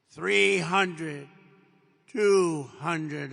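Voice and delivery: loud spaced altitude callout; computer voice callout. Retold or imitated. Cockpit computer counting down the altitude, loud callout. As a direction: call the numbers like the plane's computer. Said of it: "you have the computer voice".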